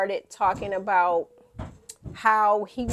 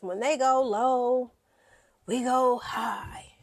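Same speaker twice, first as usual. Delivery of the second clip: quoted motto; 4 semitones higher, slower than usual. Retold or imitated. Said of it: "Michelle Obama says".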